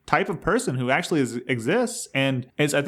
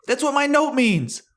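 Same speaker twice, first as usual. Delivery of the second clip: excited; 11 semitones higher, 3 dB louder than usual. Real, not acted. Excited realization about note.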